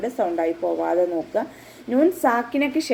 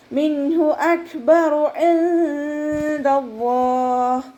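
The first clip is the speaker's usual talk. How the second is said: slow, drawn-out, higher chanted recitation; Quran recitation; 7 semitones higher, 3 dB louder, much slower than usual. Shown as a demonstration. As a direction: recite it slowly with tajweed. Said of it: "മിൻഹു മണിക്കാതെ".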